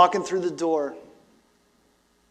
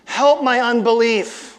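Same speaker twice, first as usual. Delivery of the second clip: pleading cry; 5 semitones higher, 5 dB louder, slower than usual. Retold or imitated. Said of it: "And he says".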